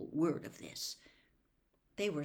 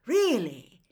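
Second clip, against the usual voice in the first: loud, raised "oh, do tell" interest; eager, higher-pitched surprise; excited; 4 semitones higher, 9 dB louder than usual. A narrator's reading. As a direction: exclaim with keen fascination. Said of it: "Mister Weasley's voice excitedly".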